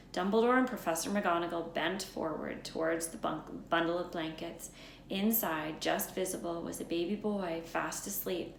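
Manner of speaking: plain reading voice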